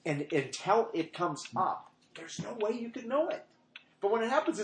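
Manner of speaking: normal talking voice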